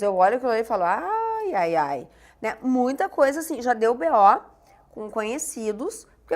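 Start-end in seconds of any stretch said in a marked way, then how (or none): 0.8-2.1 mock groan of dismay, sliding down; exasperated ai-ai-ai. Reacting to an avoid-audios note, exasperated. Acted reaction to annoying message.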